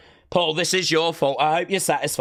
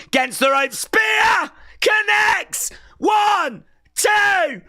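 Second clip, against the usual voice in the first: much higher, louder play-by-play yell; excited shout; 4 dB louder, slower than usual. Real, not acted. Fan calling the spear and the count, shouting. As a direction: scream the move and count the pin.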